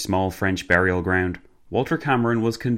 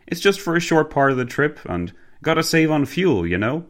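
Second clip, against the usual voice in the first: higher-pitched chummy driver; Cockney driver, apologetic; 8 semitones higher than usual. Acted. Driver excusing the shared car to his boss, apologetic.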